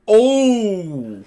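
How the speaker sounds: ordinary talk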